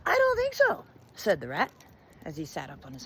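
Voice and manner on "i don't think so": very high, loud rebuttal; indignant rat. A narrator's reading. Rat contradicts the old woman, indignant. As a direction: squeak out a firm objection.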